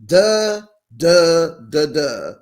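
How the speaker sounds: loud, laughing taunt on duh; mocking duh. Real, not acted. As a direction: rub it in, loud. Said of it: "mocking Jennifer's duh".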